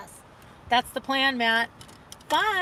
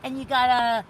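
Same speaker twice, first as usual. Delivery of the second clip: imitating Molly Shannon's character; 2 semitones higher, 7 dB louder than usual. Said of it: "She's like".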